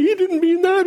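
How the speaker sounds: playful high-pitched imitation of the wife; imitating wife. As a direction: squeak it out like the wife cutting in.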